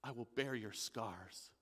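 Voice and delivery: low, soft and slow divine voice; Yahweh speaking tenderly. Acted. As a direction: comfort him slowly, barely above a murmur. God answering the thief.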